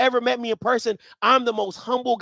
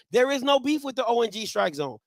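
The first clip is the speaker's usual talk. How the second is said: normal talking voice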